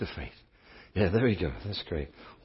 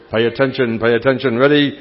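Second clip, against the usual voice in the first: loud rallying call; 3 semitones higher, 12 dB louder than usual. Real, not acted. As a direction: shout them awake. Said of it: "Let's do it again".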